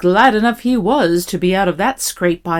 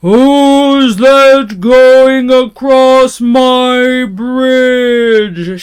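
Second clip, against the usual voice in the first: great loud, drawn-out troll roar; troll's shout; 5 semitones higher, 9 dB louder, much slower than usual. A narrator's reading. Troll challenging whoever crosses his bridge, great loud shout. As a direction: bellow it very slowly as a troll. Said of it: "shouted the troll in his great loud voice".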